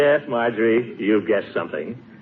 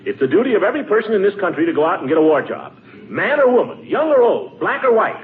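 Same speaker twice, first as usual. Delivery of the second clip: thump the podium like a blowhard; pompous rising oration; 8 semitones higher than usual. Acted. Gildersleeve's rising patriotic speech.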